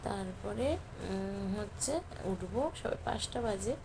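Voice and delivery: speaking softly so the sleeping son stays asleep; hushed talk. Real, not acted. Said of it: "আমি আস্তে আস্তে কথা বলছি".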